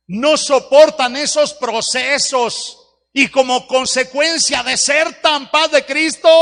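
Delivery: rising to a loud, high call; full-force sermon climax; preaching cry. Real, not acted. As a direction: build it into a ringing preacher's shout.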